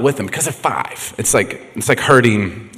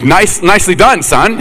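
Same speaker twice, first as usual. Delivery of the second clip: loud, raised mock praise; sarcastic dad; 7 semitones higher, 5 dB louder than usual. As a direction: play a dad scolding with sarcasm. Acted scolding of his son.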